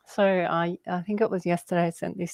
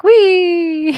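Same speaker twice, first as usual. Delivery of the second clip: very high, loud squealing "Wee!"; gleeful frog squeal; 12 semitones higher, 10 dB louder than usual. Retold or imitated. Telling a friend how a frog threw itself, squealing. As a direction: squeal like a frog leaping for joy. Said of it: "the frog's like".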